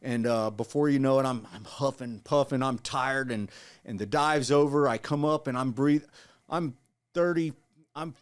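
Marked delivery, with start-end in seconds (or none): none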